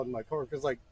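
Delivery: ordinary delivery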